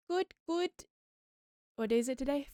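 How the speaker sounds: ordinary talk, nothing marked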